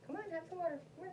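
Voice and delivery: high sing-song coaxing; coaxing a pet. Real, not acted. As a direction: sweetly urge the dog. Coaxing dog.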